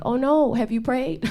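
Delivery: lightly voiced fretting mother; worried mom. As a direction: mom fussing over prayer, lightly voiced. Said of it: "she's always like".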